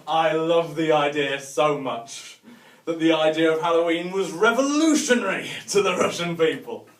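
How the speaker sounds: usual speaking voice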